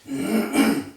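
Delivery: harsh throat-clearing splutter; spluttering cough. Real, not acted. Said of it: "Sorry about the coughing and spluttering".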